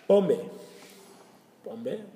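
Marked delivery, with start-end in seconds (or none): 0.1-0.5 called out high and loud; emphatic announcement. Shown as a demonstration. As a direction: announce Pombe, loud and high, to grab attention. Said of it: "teacher introduces new word".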